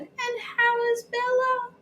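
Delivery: neighbour voice. Acted. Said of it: "she said".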